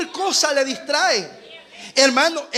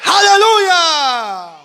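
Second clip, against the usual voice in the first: very slow, loud, higher drawn-out hallelujah; shouted hallelujah; 4 semitones higher, 7 dB louder, much slower than usual. Real, not acted. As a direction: stretch it like a preaching climax. Preacher's climax shout.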